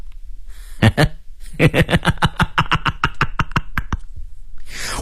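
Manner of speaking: long staccato villain's cackle; villain's laugh. Acted. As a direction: laugh on and on like a crime boss. Gang boss laughs menacingly. (反派老大的长笑)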